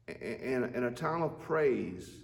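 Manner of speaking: ordinary delivery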